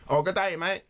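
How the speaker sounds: put-on a light, half-hearted Australian accent; lightly imitating an Australian wildlife expert; weak Australian. Reacting to a video, a light Australian accent like a wildlife expert. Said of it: "I can't do a good Australian".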